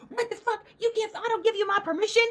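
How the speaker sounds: high, fast mock outrage; mimicking the woman objecting to being filmed, high and fast; outraged woman. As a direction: shriek it like the angry woman. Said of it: "And she's like".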